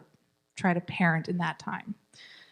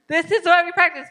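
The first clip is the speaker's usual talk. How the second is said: higher, louder and quicker; emphatic repeat; 8 semitones higher, 6 dB louder than usual. Real, not acted. Teacher repeating to class, higher and louder.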